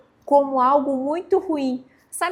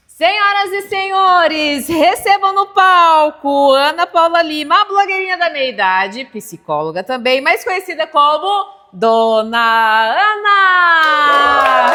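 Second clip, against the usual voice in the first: louder, higher booming emcee voice; grand entrance announcement; 5 semitones higher, 9 dB louder than usual. Shown as a demonstration. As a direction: announce the star, slow and grand. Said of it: "vou me apresentar e quando eu voltar".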